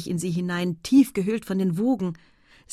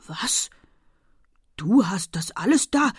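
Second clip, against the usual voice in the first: stunned question, growing louder; utterly astonished; 4 dB louder than usual. A narrator's reading. Husband sees her basket of shrimps, dumbfounded. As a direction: take it in, then exclaim amazed. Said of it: "rief er höchst baff".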